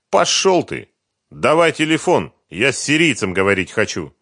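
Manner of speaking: loud, high, demanding retort; defiant outburst. A narrator's reading. Сухарев demanding a phone from Фёдор, defiant. Defiant captive in acted quarrel.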